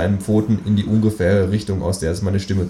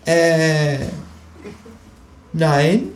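high, hesitant kangaroo denial; sheepish kangaroo; 9 semitones higher than usual. Acted. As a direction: fake innocence, high pitched. Kangaroo caught lying.

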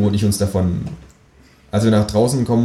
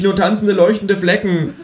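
high, distressed wail; calling out; 12 semitones higher than usual. A narrator's reading. Dazzled man describing spots, calling out high. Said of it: "rufe ich".

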